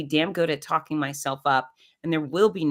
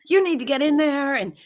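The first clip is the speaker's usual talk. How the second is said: higher, louder scolding in the nurse's voice; imitating angry nurse; 9 semitones higher, 4 dB louder than usual. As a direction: mimic the nurse telling her off. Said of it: "She was really angry".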